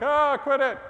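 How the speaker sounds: high, loud irritated "Ah, quit it"; annoyed crowd. Retold or imitated. Crowd scolding Jesus and Jairus, loud and high irritation. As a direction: snap it like a fed-up mourner. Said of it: "the crowd's like".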